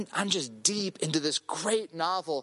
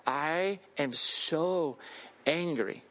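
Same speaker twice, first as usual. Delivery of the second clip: slow, low retold anger; contained anger; 4 semitones lower, much slower than usual. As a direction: draw it out, seething under control. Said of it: "sinning in my mind about my friend".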